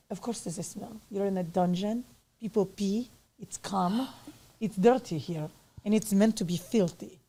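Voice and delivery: put-on Russian accent. Dominatrix answering an interviewer about her dungeon, in a Russian accent. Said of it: "That's a great accent".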